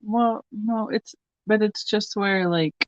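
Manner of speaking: soft, careful talk through soreness; words held back by a sore jaw; sore mouth. Real, not acted. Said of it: "Hurts to talk a little bit".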